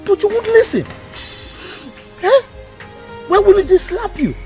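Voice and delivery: sharp, high scolding rebuke. Acted. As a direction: tear into her for disobeying. Uncle scolding crying niece.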